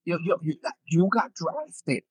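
retold stunned news-breaking. Brother telling player he got drafted, hushed amazement. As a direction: break the news softly, stretching the last words. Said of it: "He goes".

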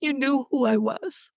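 getting choked up while telling the story. Real, not acted. Telling the wife's story on a podcast, really choked up. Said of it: "I'm gonna get choked up".

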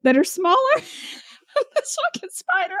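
ordinary talk, nothing marked

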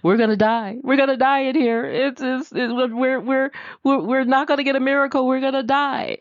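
high, frantic squeal of doom; a frog sure it will die, voice jumping up high; the pessimist brother wails in panic that they are going to die; panicky pessimist. Acted. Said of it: "who's a pessimist".

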